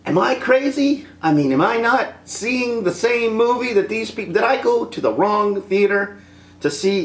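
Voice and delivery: high, incredulous mock disbelief; bewildered moviegoer. Vlogger mimics puzzled fans, high disbelief. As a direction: act baffled, voice up high. Mock audience member wondering.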